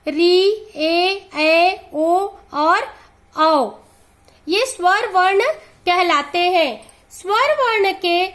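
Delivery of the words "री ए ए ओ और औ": slow recitation. Shown as a demonstration. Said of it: "इसकी संख्या ग्यारह है।".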